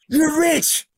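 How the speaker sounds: high, thrilled shout of praise; excited friends. Retold or imitated. Buddies cheering at the hundred K, high and excited. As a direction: squeal it like they won. Buddies falling out over money.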